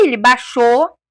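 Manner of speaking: ordinary talk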